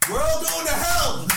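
crowd-taunting slow whoop; gleeful yell. Retold or imitated. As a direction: holler it at the crowd as they walk off. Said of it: "I just literally went".

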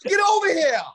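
put-on loud New York accent shout. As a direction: yell it like a New Yorker. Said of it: "my New York accent would be fuego".

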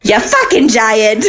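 slow, drawn-out New Jersey mom insult; New Jersey accent. Retold or imitated. Host replays the mom's dig for laughs, New Jersey accent. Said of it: "New Jersey right now".

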